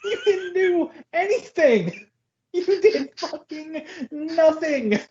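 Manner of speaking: high put-on voice calling Yoda out; incredulous high mock rant. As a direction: scold Yoda in a strained squeak.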